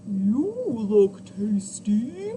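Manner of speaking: slow, loud mooing cow; drawn-out hungry cow. A narrator's reading. Narrator voicing the hungry cow to children. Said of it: "mooed the cow".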